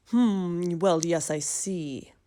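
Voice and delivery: lightly voiced mumbling doctor on the phone; doctor mumbles into the phone. A narrator's reading. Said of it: "Doctor Bumble mumbled".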